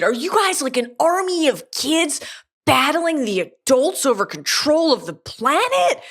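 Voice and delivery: imitating an excited boy, pitch up; excited kid. Wim asking the strange kids if they fight adults, in an excited kid voice. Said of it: "he's excited".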